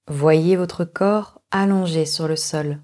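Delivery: normal talking voice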